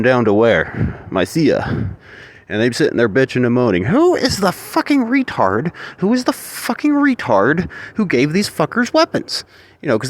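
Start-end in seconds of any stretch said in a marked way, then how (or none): 3.8-9.4 outraged rant. Retold or imitated. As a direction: demand to know who armed them, pitched way up and dragged out. Outraged locals complaining about armed invaders.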